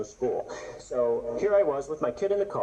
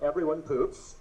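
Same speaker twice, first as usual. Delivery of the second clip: ordinary talk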